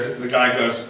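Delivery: ordinary talk, nothing marked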